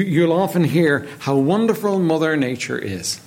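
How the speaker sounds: ordinary delivery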